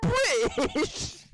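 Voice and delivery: squeaky rising mock astonishment; high-pitched meme impression. Retelling the British guy meme, high-pitched imitation. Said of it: "so high pitched at the end".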